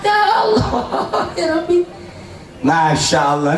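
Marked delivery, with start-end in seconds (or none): 0.0-1.8 high laughing cry to God; real laughter breaking into a high cry; laughing exclamation. Real, not acted. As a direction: laugh through the words.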